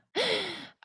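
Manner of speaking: high, audible gasp; gasping inhale. Real, not acted. Teacher over-accentuating the breath, with a high gasp. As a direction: draw breath in sharply and audibly. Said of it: "It sounds like I'm gasping".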